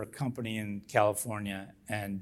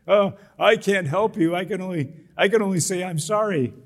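a higher, louder sarcastic parrot; mocking the manager; 8 semitones higher, 6 dB louder than usual. Mimicking the teen manager to mock him, a higher, louder sneer. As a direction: imitate the manager's useless apology with scorn. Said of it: "which enraged me even more".

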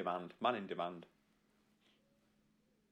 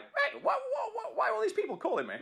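high-pitched, fast mock bewilderment; imagined bewildered guest; faster than usual. Guest flooded with calls after number leak, high mock outrage. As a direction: stammer it out in a squeaky, hammed-up protest. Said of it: "He'd be like".

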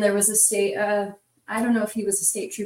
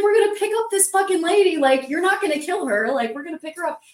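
high, insistent imitation of an argument; heated retort; 9 semitones higher, 4 dB louder, faster than usual. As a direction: overrule the passenger, pitched up and urgent. Housemate arguing back, retold.

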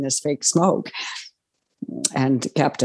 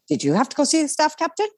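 much higher, fast mock-indignant question; 12 semitones higher, faster than usual. Crew member quizzing Peter about the captain, much higher and fast. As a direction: squeak it out fast, put out. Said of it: "went to Peter and said".

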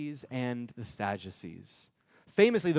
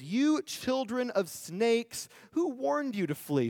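much higher, slow fiery prophet denunciation; John condemning; 8 semitones higher, slower than usual. Retold or imitated. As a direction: rain scorn on them, drawing each phrase out. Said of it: "He says".

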